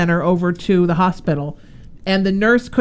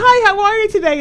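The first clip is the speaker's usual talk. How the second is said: much higher, louder singsong hello; mock cheery greeting; 14 semitones higher, 4 dB louder than usual. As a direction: beam a sugary greeting at a stranger. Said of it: "and I said".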